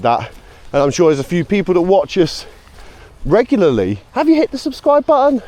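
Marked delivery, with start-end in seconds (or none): 4.1-5.4 playful teasing question. Pretend. Teasing regular viewers.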